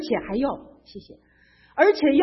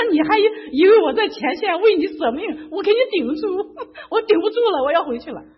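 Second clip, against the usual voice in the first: high, loud comic protest; loud plea; 7 semitones higher, 6 dB louder than usual. Retold or imitated. Telling her friend she can't hold on, loud comic protest. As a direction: cry out that you can't hold on. Retold whining phone call to friend.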